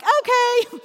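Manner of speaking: put-on high, loud, chirpy kid reply; excited kids. Kids answering mom about swimming, excited. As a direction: chime in like eager little kids.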